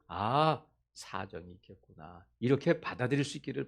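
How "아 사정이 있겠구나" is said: soft, much quieter acceptance; understanding reply. Shown as a demonstration. Preacher models a gentle, accepting answer. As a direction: let it land gently. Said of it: "이렇게 말하지 말고".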